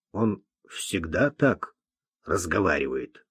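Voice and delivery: hushed, low aside; soft, low-pitched private question; whispered aside. A narrator's reading. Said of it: "шепнул он ей".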